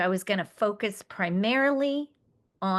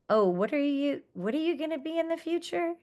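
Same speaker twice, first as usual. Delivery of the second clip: higher, brighter-pitched, quicker counselor voice with a friendly lilt; cheerful counselor; 7 semitones higher, faster than usual. Retold or imitated. Retelling a counselor asking a student about the future, higher and quicker. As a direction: lift your voice up high and ask it eagerly, like a kind counselor.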